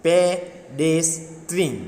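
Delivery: very slow "pedestrian", pe... des... trian; syllable by syllable. Shown as a demonstration. Teacher dictating a longer word, slowly syllable by syllable. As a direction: break "pedestrian" with a gap after each beat. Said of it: "Word that have more than one syllable".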